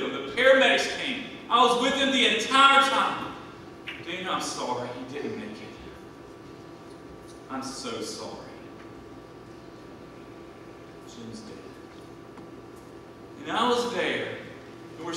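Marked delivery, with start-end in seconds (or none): 4.1-11.6 soft, hushed, sorrowful telling; breaking the death gently, barely voiced at the end; hushed bad news. Friend at the door telling Daniel his brother died, hushed.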